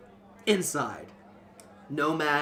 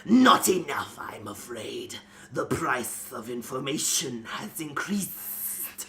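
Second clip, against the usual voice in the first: breathy snake hiss on afraid, slow after; sly serpent hissing out his price; hissing cobra man; slower than usual. Acted. Cobra man raising his price on Nomad, hissed. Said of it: "hissed the Cobra Man".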